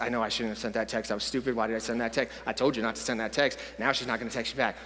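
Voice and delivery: low, hushed monotone muttering; quiet, low argument inside the head; inner self-reproach. Acted. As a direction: mumble it to yourself, regretful. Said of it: "the voice that you listen to".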